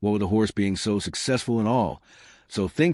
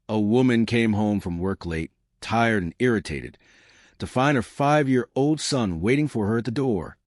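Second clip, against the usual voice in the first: usual speaking voice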